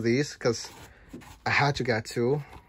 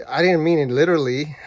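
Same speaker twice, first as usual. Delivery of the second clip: building yelling back; 4 semitones higher, 8 dB louder than usual. Retold or imitated. Building snaps back in argument.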